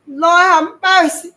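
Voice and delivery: high, loud, slow anguished wail; desperate plea. Acted. Terrified boy pleading to God, a slow loud wail. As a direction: beg for mercy, drawn out in terror. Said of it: "I pleaded again".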